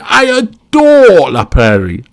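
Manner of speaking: high, loud, drawn-out rapture; gushing adoration. Acted. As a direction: swoon over it, stretching the words. Jane gushing over spa brand.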